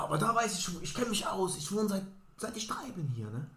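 hammed-up tipsy woman blurting directions. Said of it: "drunk woman continues".

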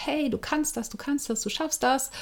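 soft encouraging inner voice. Retold or imitated. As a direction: coax yourself softly, like a kind little cheerleader. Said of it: "diese ganz leise Stimme".

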